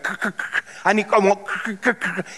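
choked stammer on the first sound of courage; stuttering Cowardly Lion. Retold or imitated. Preacher imitating the lion trying to get courage, stuttering. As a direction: get stuck on a breathy "cr-", imitating a frightened lion. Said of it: "He couldn't say courage".

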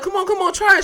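high-pitched, pushy coaxing. Retold or imitated. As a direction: imitate someone urging a reluctant taster. Acts Diamond coaxing him.

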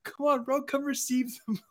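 high coaxing come-on voice; luring friend. Retold or imitated. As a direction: beckon him in, sing-song. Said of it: "And he's like".

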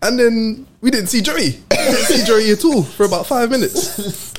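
high, drawn-out punchline delivery; slow, incredulous storytelling with a laugh; amused emphasis. Real, not acted. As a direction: stretch it out in high-pitched disbelief, laughing. Comic reveal of friend vanishing.

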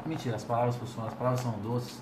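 low, soft, coaxing sweet talk; seductive. Acted. Lotan sweet-talking Rogar, low and flirty. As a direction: purr the words to win him over. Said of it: "você não tá me cantando".

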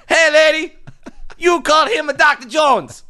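a small boy yelling in a hammed-up Chinese accent; terrible mock Chinese accent, high-pitched kid yelling; bad Chinese accent. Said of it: "That was the worst small Chinese accent ever".

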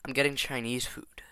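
hushed aside whisper. Real, not acted.

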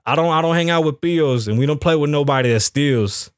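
ordinary talk, nothing marked

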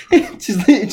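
usual speaking voice

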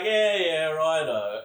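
a cocky, airy yeah yeah righto; dismissive mock reply. Aussie mocking his own brush-off of the mates' warning, airy and cocky.

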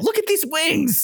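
a fed-up villain pleading to be taken seriously, drawn out; frustrated villain. Acted. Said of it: "He's like".

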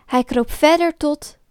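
usual speaking voice

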